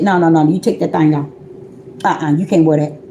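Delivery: low, firm imitated order; stern refusal. The Father tells her to take it off, low stern refusal.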